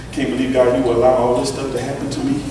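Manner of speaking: ordinary talk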